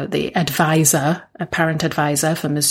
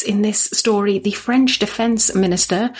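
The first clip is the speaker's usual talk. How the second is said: usual speaking voice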